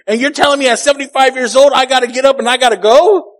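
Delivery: high, loud, fast disbelief; loud mock outrage at being told to leave; incredulous Abraham. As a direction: protest like an old man told to move. Abraham's incredulous objection voiced.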